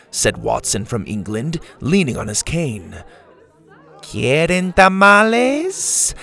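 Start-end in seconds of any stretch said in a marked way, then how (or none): 4.0-6.1 put-on Spanish accent. A narrator's reading. Dora offering tamales in Spanish.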